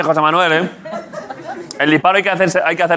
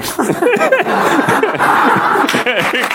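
high helpless laughter; burst of laughter; 9 semitones higher than usual. Real, not acted. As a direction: crack up laughing. Friends joking while aiming gun.

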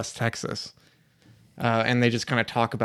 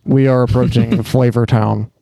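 loud laughing captain announcement; hammed-up pilot, breaking into laughter; 10 dB louder than usual. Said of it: "a pilot on an airplane".

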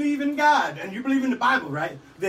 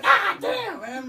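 mock yelp; 10 semitones higher, much slower than usual. Mind reacting to his joke.

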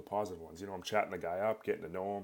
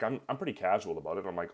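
usual speaking voice

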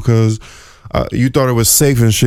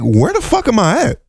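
baffled; 6 semitones higher than usual. Retold or imitated. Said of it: "I'm like".

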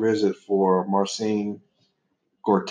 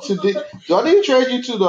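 mock threat; 3 dB louder, faster than usual. Mock threat to his son.

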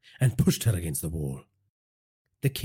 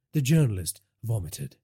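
plain reading voice

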